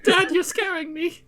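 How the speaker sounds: mock scared kid. Son teasing dad's mock fight.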